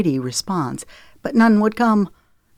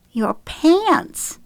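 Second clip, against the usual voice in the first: giggling; 12 semitones higher than usual. A narrator's reading. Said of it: "Madison answered with a giggle".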